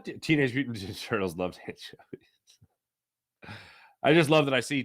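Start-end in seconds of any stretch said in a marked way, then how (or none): 0.0-2.3 whisper. Pretend. Whispered trivia aside.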